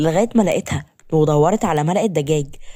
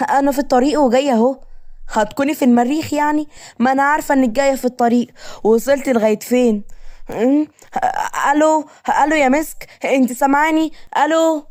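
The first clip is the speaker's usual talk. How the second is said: higher-pitched, lightly voiced exasperated young woman; Rewan on the phone; 7 semitones higher than usual. Woman calling to her friend on a dropping line, mildly exasperated.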